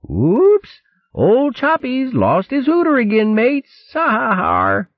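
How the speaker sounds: very high, cackling rat sailors; jeering crew. Acted. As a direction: hoot at the captain's lost nose. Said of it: "bold ones began yelling".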